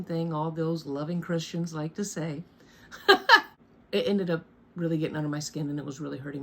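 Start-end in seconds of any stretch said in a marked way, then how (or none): 2.9-3.5 loud, high burst of laughter; real laugh. Real, not acted. After jab at loving Christians, loud real laugh. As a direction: burst out laughing at your own dig.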